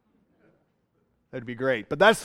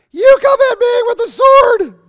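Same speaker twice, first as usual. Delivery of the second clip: put-on much higher, loud squeaky boy's voice; a prepubescent boy squeaking out a taunt; voice cracking; 10 dB louder than usual. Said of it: "his voice hasn't changed yet".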